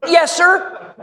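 tiny high-pitched mock-cowed voice; meek high reply. Threatened assistant answering the man with a pistol, meek squeak.

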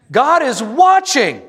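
pastor's climactic pulpit cry; booming proclamation. Acted. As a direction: thunder it out to the congregation. Repeats the key line bigger.

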